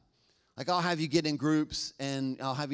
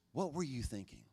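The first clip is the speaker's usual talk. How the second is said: much softer, gentle curious question; compassionate; 8 dB quieter than usual. Shown as a demonstration. Pastor asking someone kindly, soft compassionate question. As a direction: ask it with real care, softly. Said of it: "To a more compassionate and honoring".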